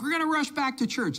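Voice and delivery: high-pitched mimicked enthusiasm; eager crowd quote. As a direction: imitate everyone eagerly rushing back. Voicing an excited crowd.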